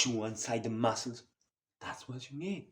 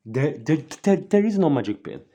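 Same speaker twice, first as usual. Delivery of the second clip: shouting; 5 semitones higher, 10 dB louder than usual. Real, not acted. Hammering his point to viewers.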